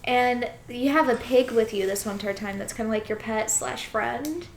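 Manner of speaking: usual speaking voice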